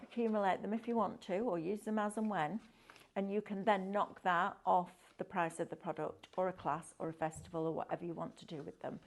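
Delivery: ordinary talk, nothing marked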